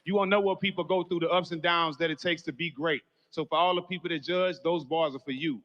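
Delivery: loud, driving preacher's build over every line; preaching at full force. Acted. Friend preaching loud at a funeral for everyone. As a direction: testify loud and high to the whole room. Opening lines of a funeral tribute.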